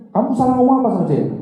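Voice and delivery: high, loud accusing question; angry interrogation. Retold or imitated. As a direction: grill him angrily. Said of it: "Marah sih itu".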